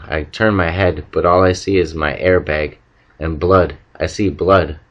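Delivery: ordinary delivery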